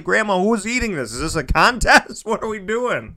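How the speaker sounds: high, playful protest; mock disbelief. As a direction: exclaim in amused bafflement at grandma. Acted protest at grandma's huge meal.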